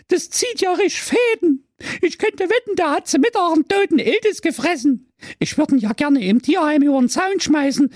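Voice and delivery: very high squealing disgust; disgusted sister. Acted. Sister licked by the pug, very high disgusted voice. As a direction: squeal in revulsion at the slobber. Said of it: "Dem Brudi seine Schwester weinte".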